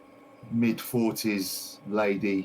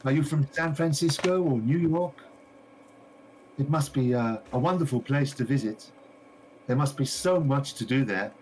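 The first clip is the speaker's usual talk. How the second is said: higher, eager shopkeeper voice; gushing shopkeeper lady; 7 semitones higher than usual. Acted. A game master voicing the shopkeeper greeting a visitor, high and eager. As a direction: play the delighted lady greeting her customer. Shopkeeper asks about America.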